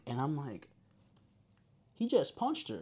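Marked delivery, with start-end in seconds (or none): 1.9-2.8 high-pitched, stunned mock report; imitated startled eyewitness on a call; acted shock. Acts out shocked report to operator.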